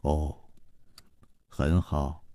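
low and soft, slow and heavy; curt, chilly acknowledgment; cold low reply. A narrator's reading. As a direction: answer coldly from deep down. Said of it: "沉沉地呃了一声".

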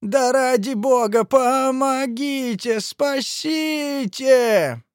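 high, drawn-out desperate wail; long pleading yells; screaming for help. A narrator's reading. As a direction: beg to be saved, stretching the cries. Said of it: "заорал тип в лиловой рубашке".

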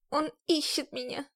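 ordinary delivery

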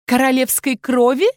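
high, rising incredulous question; strained sneer. A narrator's reading. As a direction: throw it back through a twisted lip. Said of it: "натянуто скривила губы Ельсия".

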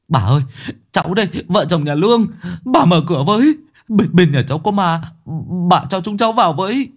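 high, hurried begging; urgent pleading. A narrator's reading. Begging neighbour to open.

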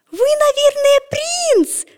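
put-on very high, wondering girl's voice; dreamy little girl hoping he is a prince; awed little girl. A narrator's reading. Girl asks Petya.

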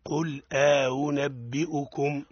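slow قل أنبئكم with the hamza eased; model recitation. Shown as a demonstration. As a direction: recite قل أنبئكم slowly for the student to copy. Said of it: "أعدها". (تلاوة نموذجية بطيئة)

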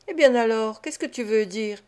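ordinary delivery, nothing marked

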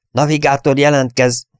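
captain's roll-call order; calling to report. A narrator's reading. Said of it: "szólította Dennis".

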